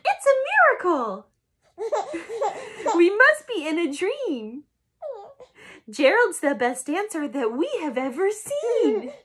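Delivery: lightly voiced cheering animals; storybook animals cheering. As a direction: lift it up as the astonished animals.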